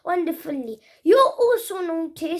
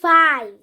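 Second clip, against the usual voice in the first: five said loud and falling; "five" announced with a firm fall; announced word; 7 dB louder than usual. Shown as a demonstration. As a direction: call out "five" clearly. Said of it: "sounds I'll be pronouncing are".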